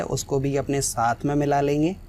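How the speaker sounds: normal talking voice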